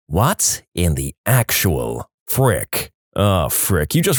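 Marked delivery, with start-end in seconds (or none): 0.1-2.9 low, very slow, word-by-word incredulity; deadpan, drawn-out bafflement; stunned disbelief. Acted. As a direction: drag out each word in exasperated disbelief. Narrator's disbelief at the story.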